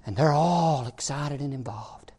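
suddenly low, near-whisper; very soft, low pulpit hush; hushed preaching. Real, not acted. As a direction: drop to a hush for effect. Sudden hush after fervent preaching.

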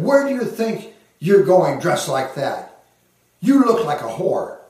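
mildly acidic imitation of a scolding father; a touch of acid, as an angry parent retold; retold acidic reproach. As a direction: quote the father's cutting rebuke with some bite. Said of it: "in a very acidic tone of voice".

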